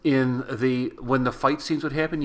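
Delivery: ordinary delivery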